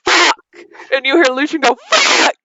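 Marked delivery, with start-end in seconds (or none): 0.0-0.4 sudden cursing outburst in character; exclaimed curse. Acted. Game master voices Leaf's curse. 1.9-2.4 very high cursing yelp in character; panicked yelp. Acted. Game master voices Lucian's curse.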